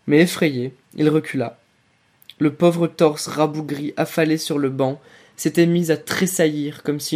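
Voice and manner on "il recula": plain reading voice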